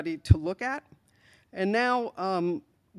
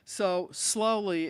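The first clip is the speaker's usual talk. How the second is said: slowly drawn out long and heavy, very slow; stress on slowly; much slower than usual. Real, not acted. Tech chief telling the audience about progress, stressing "slowly". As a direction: lean hard on "slowly", stretch it out. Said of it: "let me emphasize the word slowly".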